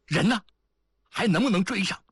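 high, urgent demand; anxious questioning. A narrator's reading. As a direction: ask urgently, pitched right up. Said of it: "着急地问道". (着急地高声追问)

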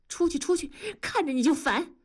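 high-pitched, annoyed banishment; irritated dismissal. A narrator's reading. Wife snatches the quilt and orders husband out, irritated. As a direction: send him off in a high, fed-up voice.

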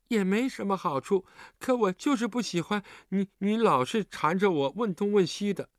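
put-on high-pitched, sulky child voice; huffy child. A narrator's reading. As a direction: complain like a child in a huff. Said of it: "寒冰气呼呼地说".

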